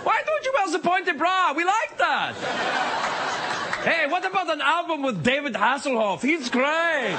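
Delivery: high cheery mock German accent; German tourists. Tourists greeting [name], cheery mock German accent. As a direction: chirp it like a hiker in lederhosen. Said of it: "German tourists in lederhosen".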